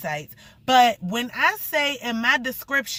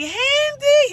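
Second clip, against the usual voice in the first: squealed name, much higher and louder; excited call; 7 dB louder than usual. Retold or imitated. Greeting her friend Handy outside, a high excited squeal. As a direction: call him across the street, thrilled.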